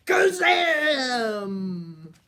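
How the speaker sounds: loud, drawn-out spell cry; magic shout. Acted. Grandma reads the card and shouts the spell loudly. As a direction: boom out the magic word.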